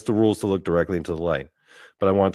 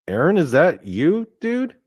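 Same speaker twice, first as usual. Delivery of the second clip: rising higher in disbelief on dude; stunned recognition; 8 semitones higher, 3 dB louder than usual. Retold or imitated. Dreamer recognizing the dead kid in a new body, high astonished question. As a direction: ask it like you can't believe your eyes.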